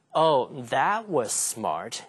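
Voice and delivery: slow, drawn-out mockery; teasing, sarcastic slow delivery. Shown as a demonstration. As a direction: stretch it out as fake praise. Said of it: "而是在挖苦你".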